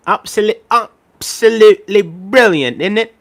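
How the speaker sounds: high, slow "absolutely brilliant, isn't it?" in a put-on British voice; British accent. Shown as a demonstration. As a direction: ham up a posh Brit. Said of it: "saying absolutely in a British accent".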